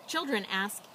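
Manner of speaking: usual speaking voice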